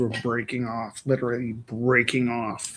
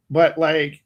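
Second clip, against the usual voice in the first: ordinary delivery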